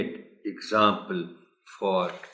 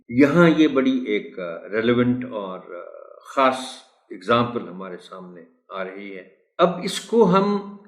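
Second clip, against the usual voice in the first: usual speaking voice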